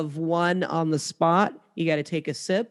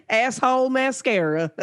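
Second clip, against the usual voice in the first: Southern twang; 6 semitones higher than usual. Pretend. Host showing the player the product name, Southern twang. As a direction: lay on a playful Southern twang. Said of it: "We need that Southern twang".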